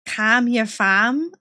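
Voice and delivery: slow, careful copy of Scouse; questioning beginner's Scouse; Liverpool accent attempt. Shown as a demonstration. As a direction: repeat it slowly in a tentative Liverpool accent. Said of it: "How to do a Liverpool accent".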